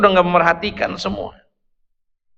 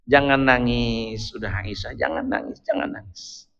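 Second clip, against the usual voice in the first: soothing hush; 9 semitones lower, 8 dB quieter than usual. Acted. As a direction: lower and much softer, fading to a whisper. Soothing Aisyah, fading to a whisper. (bisikan lembut menenangkan)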